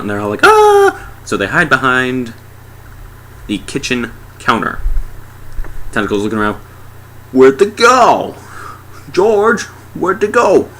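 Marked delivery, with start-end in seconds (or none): 0.4-1.1 high, loud comic shriek; freaked-out scream. Acted. They're all freaking out at the tentacle, panicked scream. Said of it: "they're all freaking out". 7.3-10.7 high, loud, drawn-out cartoon creature; searching tentacle. Tentacle looking around the house, high searching call.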